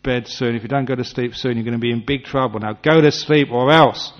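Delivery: a hammed-up parent threatening bedtime; dire warnings. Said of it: "give them dire warnings".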